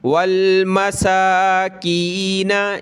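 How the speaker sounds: slow chanted recitation; teacher chanting the verse for students; Quran recitation. Shown as a demonstration. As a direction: recite slowly with a melodic lilt.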